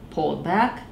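ordinary delivery, nothing marked

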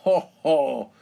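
loud, acted ho-ho; booming fake chuckle; hearty mocking laugh. A narrator's reading. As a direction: laugh out big at someone's expense. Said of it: "laughs Mister Bull".

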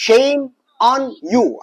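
very slow, every word drawn out; slow drill. Shown as a demonstration. Teacher drilling a phrase for students, very slow. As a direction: spell out each word slowly for the learner. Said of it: "سمعت الصوت اللي أنا بقوله".

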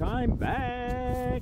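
sung up high, stretched out playfully; sing-song high repeat. Acted. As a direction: chant it like a little tune.

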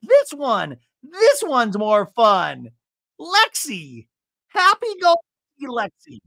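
high, slowed, sing-song boast; mocking Alexis. Alexis shimmying for the camera, high sing-song voice. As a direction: show off like a giddy party girl. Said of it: "she goes".

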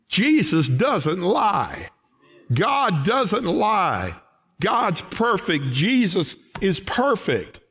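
slow, weighty, every word pressed; slow emphatic declarations. Real, not acted. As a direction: hammer each short truth down slowly. Preacher hammering key truths.

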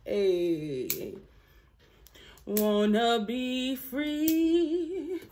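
ordinary talk, nothing marked

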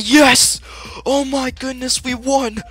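high-pitched ecstatic shriek; shrieking jackpot winner. Real, not acted. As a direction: scream with joy after winning. Winning the game.

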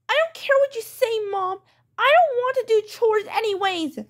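high-pitched bratty retort; defiant child. Acted. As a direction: snap back at mom like a stubborn kid. Child defies mom.

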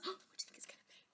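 quick, hushed whisper; conspiratorial, fast whisper; whispered aside. Pretend. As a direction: whisper the guess to the children. Aside to listeners before the reveal.

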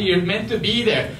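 ordinary delivery

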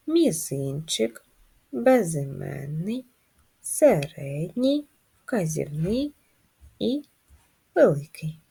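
each finger named slowly and deliberately; slow counting. Shown as a demonstration. Teacher leading finger gymnastics, slow counting.

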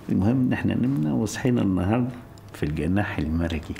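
ordinary talk

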